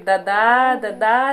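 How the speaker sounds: loud imitation of a baby calling dada; mother imitating her baby shouting dada. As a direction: copy the baby's first word, calling out. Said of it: "जोर जोर से चिल्ला रही थी".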